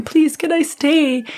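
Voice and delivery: high, drawn-out retold plea; agitated pleading. Patient begging hospital staff not to send her home, high pleading. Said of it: "agitated, and I was like".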